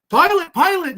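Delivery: high, loud panicked call; frantic calling. Retold or imitated. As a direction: yell for the pilot in fear. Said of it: "Lisa calling to the cockpit".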